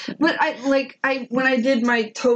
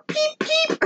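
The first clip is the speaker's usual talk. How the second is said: high-pitched little bird peeps; weird squeaks. Retold or imitated. Co-host guessing the owl's call aloud, high imitated squeaks. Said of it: "Just making weird squeaks now".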